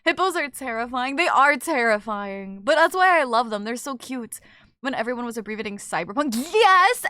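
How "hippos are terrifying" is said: ordinary delivery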